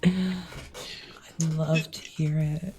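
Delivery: femme fatale. Acted.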